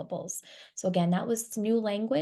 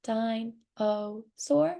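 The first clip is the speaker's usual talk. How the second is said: "dinosaur" very slow, one beat per syllable, rising at the end; much slower than usual. Shown as a demonstration. Teacher guiding listeners to feel chin drops, "dinosaur" slowly segmented. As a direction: stretch "dinosaur" into three parts, dine, oh, sore. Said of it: "there's three syllables in that word".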